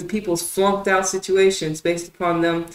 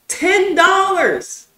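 drawn-out incredulous yell, much higher and louder; outraged shout; 10 semitones higher, 6 dB louder, much slower than usual. Real, not acted. Ranting about his tax-job wage, a slow outraged yell. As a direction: slowly bellow it as if insulted. Venting about ten dollar pay.

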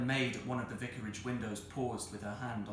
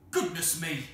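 raised, louder voice of alarm; startled exclamation; 5 semitones higher, 6 dB louder than usual. Acted. Vicar hears yelling from his doorstep, startled. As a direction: exclaim in shock.

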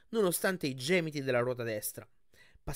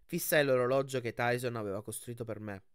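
ordinary delivery, nothing marked

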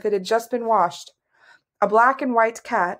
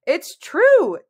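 high, louder, urgent insistence; desperate; 10 semitones higher, 5 dB louder than usual. A narrator's reading. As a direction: plead to be believed. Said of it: "Cimmerian said desperately".

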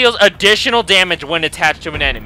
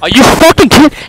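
loud, higher ranting shout; gamer rage; 7 semitones higher, 8 dB louder than usual. Real, not acted. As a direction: rant at the game. Raging at no kills.

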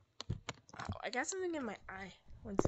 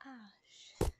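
whispered; 5 semitones lower, 3 dB quieter than usual. Real, not acted. Scared, hiding from monster.

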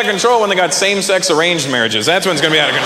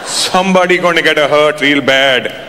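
mock-ominous threat from dad; menacing father; 3 semitones lower, 3 dB louder than usual. Dad warns son in the back, mock menace. Dad's warning to his son.

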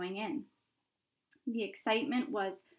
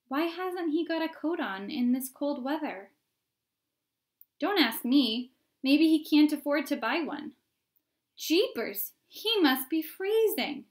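crowd onlookers; 6 semitones higher, 6 dB louder than usual. Acted.

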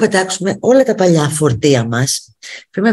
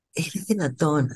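much softer, resigned disbelief; quiet repeat; 8 dB quieter than usual. Real, not acted. A vlogger repeats her disbelief under her breath. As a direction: say it almost to yourself.

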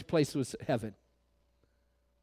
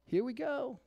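mock grumbling; 4 semitones higher, 10 dB quieter than usual. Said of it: "we want to complain to the Lord".

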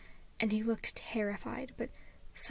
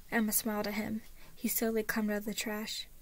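usual speaking voice

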